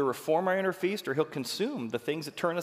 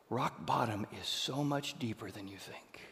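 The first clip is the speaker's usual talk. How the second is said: soft whispered confession; 6 semitones lower, 11 dB quieter than usual. Real, not acted. Preacher to the congregation, whispering. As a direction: drop to a hushed whisper.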